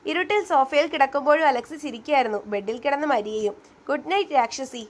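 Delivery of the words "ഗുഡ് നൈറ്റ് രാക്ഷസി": usual speaking voice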